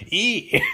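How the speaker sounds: high squeal into giggles; gleeful squeal. Acted. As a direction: squeal with mock villainous glee.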